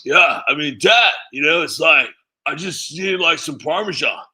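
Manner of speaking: lightly voiced Hunter bro; Hunter impression. Shown as a demonstration. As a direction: play it as a laid-back bro. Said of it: "Hunter talks like this".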